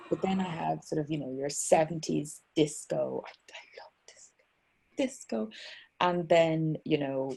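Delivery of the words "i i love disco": whispered. Real, not acted. Gushing about music, whispered.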